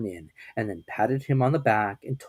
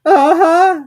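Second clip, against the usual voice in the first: nervous squeaky aha; 15 dB louder than usual. A narrator's reading. Piglet anxious.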